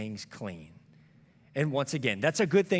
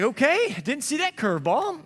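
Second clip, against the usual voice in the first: caught off guard; 13 semitones higher, 8 dB louder than usual. Retold or imitated. Rejected by his son.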